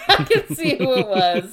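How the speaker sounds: words broken by real laughter; laughing while talking. Real, not acted. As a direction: keep laughing through the line. Laughing remembering the joke.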